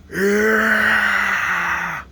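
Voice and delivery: long, high mock yell; imitated yell. Brady imitates what yelled in his ear, high mock yell. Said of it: "ran up to my ear and yelled".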